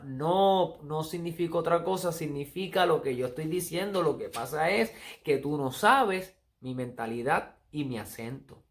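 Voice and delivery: ordinary talk, nothing marked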